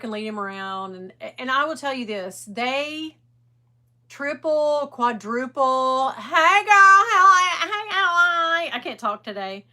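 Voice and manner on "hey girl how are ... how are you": real excited greeting, much louder and higher; called-out greeting. Real, not acted. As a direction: sing out a big hello to a friend. Greets viewers joining mid-sentence.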